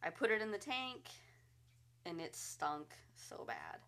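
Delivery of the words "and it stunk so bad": hushed, grossed-out whisper; whispered disgust. Real, not acted. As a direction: whisper it like the smell is awful. Disgust at rotting salmon head.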